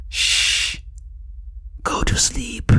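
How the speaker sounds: eerie high whisper; a whispered order to sleep, coming out of the dark; creepy whisper. Retold or imitated. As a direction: hush him like something possessed. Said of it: "That's what Nikki says".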